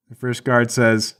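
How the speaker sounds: normal talking voice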